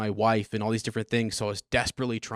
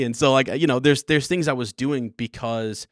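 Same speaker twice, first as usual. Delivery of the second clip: ordinary delivery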